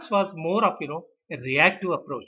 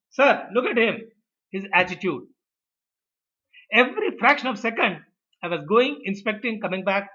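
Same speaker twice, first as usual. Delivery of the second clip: higher-pitched, aggrieved protest; indignant complaint; 4 semitones higher than usual. Acted. Narad complains to Lord Vishnu about Garud.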